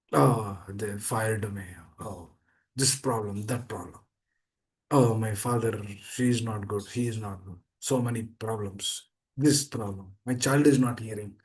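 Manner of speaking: low, sinking, mock-miserable complaint; no happiness, dejected. Preacher acting a fired person listing problems, dejected. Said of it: "on the other side we see no happiness".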